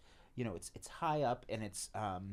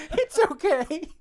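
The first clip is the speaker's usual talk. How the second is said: laughing; 13 dB louder than usual. Real, not acted. Laughing at Tip's jam plan.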